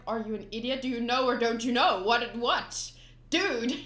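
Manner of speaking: loud fed-up outburst; exasperated Luffy. Retold or imitated. Luffy yelling at Buggy in exasperation, loud. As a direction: blow up in disbelief. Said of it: "Luffy's just like".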